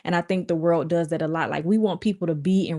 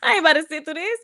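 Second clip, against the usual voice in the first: higher repeat; 10 semitones higher, 4 dB louder, faster than usual. Retold or imitated. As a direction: replay the quote pitched up, half laughing. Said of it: "repeats own quote higher".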